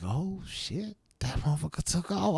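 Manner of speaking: soft, stunned aside; hushed surprise. Retold or imitated. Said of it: "I was like".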